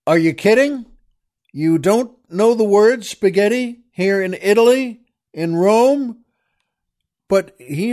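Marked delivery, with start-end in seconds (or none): 0.1-6.2 louder, higher disbelieving cry; half-mock indignation at a clueless waiter; incredulous outburst. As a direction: act floored that Rome lacks spaghetti. Imagined outrage at Italian restaurant.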